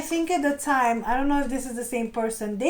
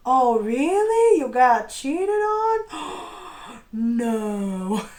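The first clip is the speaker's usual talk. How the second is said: sarcastic shock; much slower than usual. Retold or imitated. To the cheater who got cheated, sarcastic fake shock. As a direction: gasp in fake disbelief, savoring it. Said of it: "it's so sarcastic".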